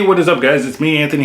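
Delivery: ordinary delivery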